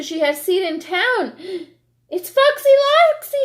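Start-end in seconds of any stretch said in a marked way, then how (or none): 2.1-3.4 high, loud panicked shriek. Acted. Chicken Little warning the birds, high shriek. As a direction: shriek the name in terror. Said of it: "she shrieked".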